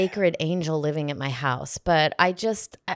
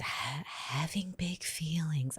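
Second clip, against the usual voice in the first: slow, soft unvoiced whisper; mock whisper; 3 semitones lower, 14 dB quieter, slower than usual. Mother mocking a parenting phrase in a hush. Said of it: "why do we have to say that too".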